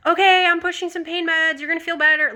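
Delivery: high-pitched nurse talking to a patient; imitated reassuring nurse.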